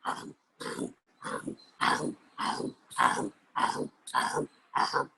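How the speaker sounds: soft breathy um sneaking; tiptoe humming. Acted. Chief hums softly, sneaking to the hole. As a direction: hum under the breath while creeping. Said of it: "he went".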